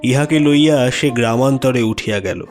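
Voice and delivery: usual speaking voice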